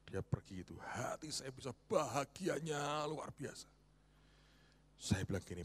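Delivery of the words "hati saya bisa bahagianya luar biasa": slow joyful wonder. Real, not acted. Pastor's joy.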